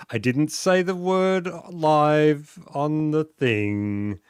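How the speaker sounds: ordinary talk, nothing marked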